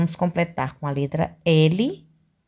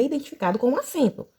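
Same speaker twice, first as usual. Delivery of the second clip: ordinary talk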